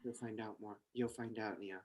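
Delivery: low hushed mysterious woman. Acted. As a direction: speak low and secretive, like a mysterious agent. Trinity voice continues.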